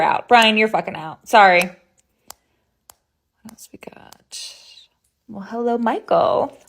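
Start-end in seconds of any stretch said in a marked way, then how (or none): none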